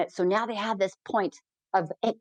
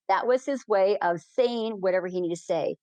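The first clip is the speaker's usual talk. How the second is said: ordinary delivery, nothing marked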